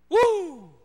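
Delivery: high loud whoop; mock worship whoop, high and loud; shout of joy. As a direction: let out a jubilant whoop. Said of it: "Grito de júbilo".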